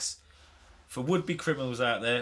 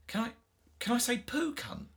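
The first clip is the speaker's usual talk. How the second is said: put-on innocent child; 8 semitones higher, 4 dB quieter than usual. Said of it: "so innocently, she went".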